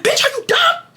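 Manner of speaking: high, scornful retold put-down; mock outrage. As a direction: sneer it at him. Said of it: "So I was like".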